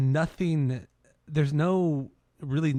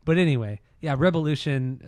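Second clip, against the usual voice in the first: ordinary delivery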